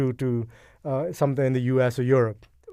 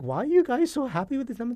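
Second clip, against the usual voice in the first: high, fast disbelieving ask; mock-baffled, pitched way up and quick; incredulous question; 10 semitones higher, faster than usual. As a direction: toss it up in puzzled disbelief. Retelling their confused reaction.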